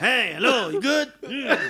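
singsong mock hello with a hum. Comic shows the rapper checking on everyone, mock singsong. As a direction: swoop through each greeting. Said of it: "dann grüßt er alle".

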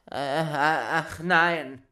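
slow, whiny innkeeper pleading; cowed host whimpering; meek cry. Acted. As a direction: beg meekly, drawn out. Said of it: "rief der Wirt ganz kleinlaut".

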